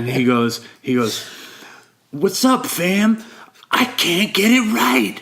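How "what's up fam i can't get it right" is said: slow, high, anguished wail building up; hammed-up despairing cry, drawn out; mock screaming. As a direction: imitate a man losing it at a meeting. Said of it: "And he's screaming".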